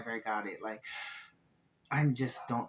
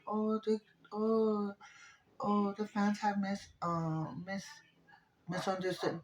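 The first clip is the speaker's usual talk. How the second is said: mocking mimicry; 8 semitones higher than usual. Mocking the network's excuse.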